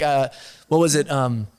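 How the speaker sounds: ordinary talk, nothing marked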